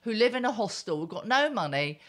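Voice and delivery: loud, high-pitched opening, then railing on; angry rant. Real, not acted. Betrayed wife ranting about men always getting women, angry. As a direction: spit out a scornful tirade.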